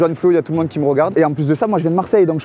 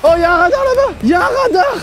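high squeaky alarm; 4 dB louder than usual. Pretend. Joking alarm call during the game.

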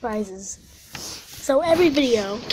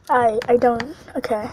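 ordinary delivery

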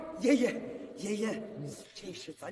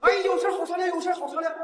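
pleading; 6 semitones higher, 6 dB louder, faster than usual. Acted. Panicked elder pleads.

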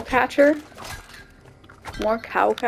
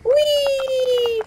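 gleeful whee. Real, not acted. Jumping off a ledge.

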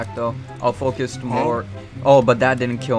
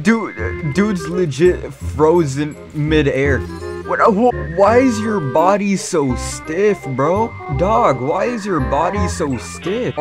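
high, loud, slow disbelief; amazed gamer; 6 semitones higher, 7 dB louder, slower than usual. Real, not acted. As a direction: stretch out each word in disbelief. Amazed at frozen enemy.

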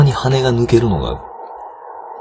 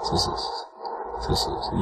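unvoiced, breathy そうそう; whispered yes yes; 11 dB quieter, faster than usual. Real, not acted. Gamer whispering encouragement to himself. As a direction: whisper along with the move.

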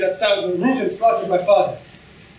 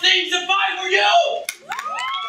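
shouted threat; 9 semitones higher, much slower than usual. Acted. Threatening warning in a duel.

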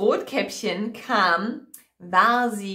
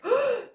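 sharp high gasp of fright; startled gasp. A narrator's reading. Girl sees the wolf leap out, sharp frightened gasp. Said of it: "Und Rotkäppchen erschrak".

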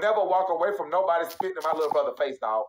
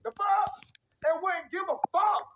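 higher, slower, shouting his threat; heated shout; 9 semitones higher than usual. Real, not acted. Ranting to the stream about his brother, heated shout. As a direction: raise the voice and drag out the threat.

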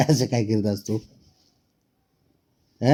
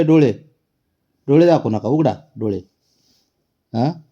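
mock scolding; 5 semitones higher, 6 dB louder than usual. Real, not acted. Loudly scolding the cat.

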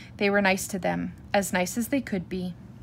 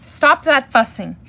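loud, raised fatherly command; loudest Papa voice; 5 semitones higher, 9 dB louder than usual. A narrator's reading. Papa bear stopping the fussing cubs, loudly commanding. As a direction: shout it like a fed-up dad. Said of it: "in his loudest Papa voice".

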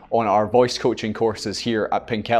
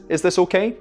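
rising, unsure "Is this okay?" with the pitch lifting at the end; hesitant upward question; 5 semitones higher than usual. Shown as a demonstration. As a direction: ask it like you need approval. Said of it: "It does sound like I'm asking questions".

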